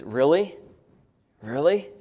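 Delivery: incredulous rising question; sarcastic complaint. Acted. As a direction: act put out, lifting the end of each word. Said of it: "in a tone of sarcasm and complaining".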